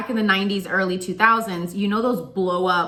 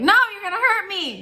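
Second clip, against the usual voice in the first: mock fear; 12 semitones higher, 3 dB louder than usual. Refusing to open the door.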